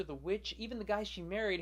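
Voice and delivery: normal talking voice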